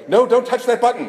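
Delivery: high, mock grandma scolding; grandma warning. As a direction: squeak it out like a fussing grandma. Grandma scolding while photo taken.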